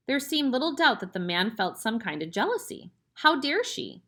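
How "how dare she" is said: jealous outrage. A narrator's reading. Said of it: "he seemed to be saying".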